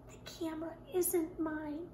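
a shy confession, very soft; very small voice. Acted. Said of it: "in a very small voice".

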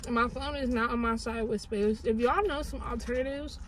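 talking while chewing; biting food between words; mouth full of chicken. Real, not acted.

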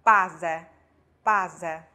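base said as BA-ze, very slowly. Shown as a demonstration. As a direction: model base with a buzzed z. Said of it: "夹在啊和i两个元音中间".

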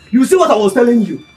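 shouted scolding. Acted. Man scolding friend who was shot at, shouted scolding. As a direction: shout the reproach at your friend. Scolding repeat of the same words.

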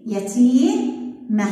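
يتيمة drawn out very slowly; slow model reading. Shown as a demonstration. Teacher to first grade, modelling the right form slowly. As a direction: stretch يتيمة so they can hear it. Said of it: "مين الأصح".

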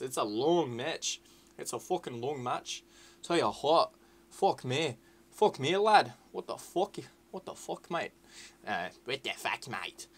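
badly faked Scottish accent; shitty Scottish accent. Gamer grumbling to viewers about a long match, mock Scottish accent. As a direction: swear away in a hammed-up Scottish accent. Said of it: "enough with the shitty Scottish accent".

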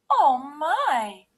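high, loud, shocked gasp; Mommy Bear. A narrator's reading. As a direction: exclaim in motherly shock. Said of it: "gasped Mommy Bear".

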